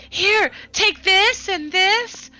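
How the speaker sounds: high, loud, drawn-out eagerness; excited urging. Acted. Althea handing overflowing items to Cassandra, excited. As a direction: pile it on breathlessly eager, pitch way up.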